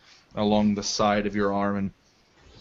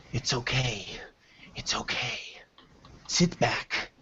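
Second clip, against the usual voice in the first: soft unvoiced whisper to a waking prisoner; hushed hobgoblin woman; 2 semitones higher, 8 dB quieter than usual. Hobgoblin whispers to the waking prisoner, hushed. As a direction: whisper it to calm her down. GM voices gentle hobgoblin nurse.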